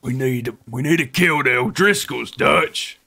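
loud, high, worked-up outlaw impression; hammed-up outlaw voice, pushed loud; Arthur Morgan. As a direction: holler to your gang leader like a game outlaw. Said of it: "Arthur Morgan impression".

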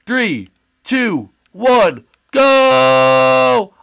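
loud, rising countdown with a long final "go"; shouted race countdown. Acted. Race starter to the bunnies, shouting them off. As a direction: call the start, building to a high shout.

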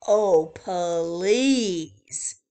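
loud, dismissive oh please; scoffing Rabbit. Acted. Rabbit scoffing at Wordy Birdie, loud and dismissive. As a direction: roll your eyes at it. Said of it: "Rabbit says".

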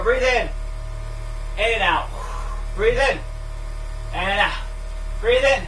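rising on in, dropping on out; breathing coach cues. Real, not acted. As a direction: count the breaths like a trainer. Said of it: "coaching breathing cues".

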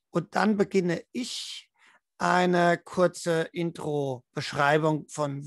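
usual speaking voice

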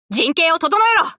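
high commanding shout; battle command shout; shouted order. Acted. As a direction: bark at the fleet to reform.

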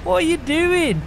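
high, loud call out the car window; teasing shout at a slow friend. Real, not acted. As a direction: yell it out to the driver ahead. Calling out to slow scooter driver.

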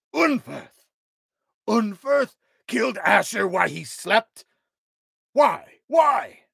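high, loud furious disbelief; outraged shout. Acted. King learning his friend's killer, shouting in fury. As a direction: bellow the traitor's name in rage.

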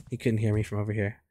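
ordinary delivery

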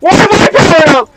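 loud, high, baffled yelling; exasperated shouting. Real, not acted. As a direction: yell it again and again in disbelief. Repeated outraged yelling at game.

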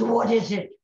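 put-on loud Irish-accented grunt of a reply; Irish pub drunk. Hunched-over Paddy answers his drinking mates, loud Irish brogue. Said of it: "they go over to where Paddy is sitting".

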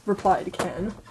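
ordinary delivery, nothing marked

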